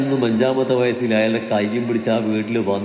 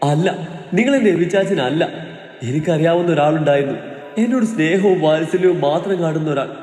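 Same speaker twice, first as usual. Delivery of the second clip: high, raised voice, shrieking at him; shrieking defiance; 6 semitones higher than usual. A narrator's reading. Terrified woman shrieks she doesn't know him, high. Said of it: "ശബ്ദം ഉയർത്തിക്കൊണ്ട് നീയെ ചീറി വിളിച്ചു".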